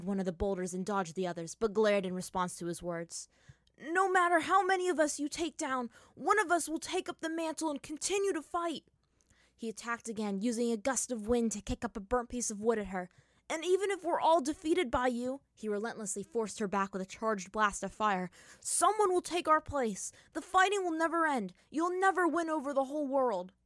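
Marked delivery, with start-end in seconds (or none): 3.8-8.8 put-on defiant young hero. Hero defies villain in battle. 13.5-15.4 put-on defiant young hero. Hero defies mid-attack. 18.6-23.5 put-on defiant young hero. Hero defies mid-attack.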